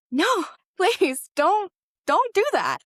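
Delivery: high, loud panicked plea; retold freaking out. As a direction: act out begging him to stop. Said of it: "And I'm freaking out".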